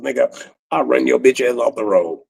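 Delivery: ordinary talk, nothing marked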